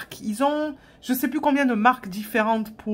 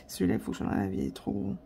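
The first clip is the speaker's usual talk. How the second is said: muttered aside; 4 semitones lower, 11 dB quieter, faster than usual. Real, not acted. Muttering to herself, off-topic.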